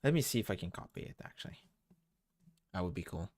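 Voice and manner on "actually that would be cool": ordinary delivery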